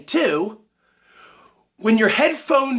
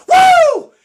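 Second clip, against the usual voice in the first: hype woo yell; 11 dB louder than usual. Real, not acted. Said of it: "hyping up the viewers".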